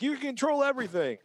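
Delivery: high-pitched gushing over a selling point; mock enthusiasm. Upbeat selling point, then its catch.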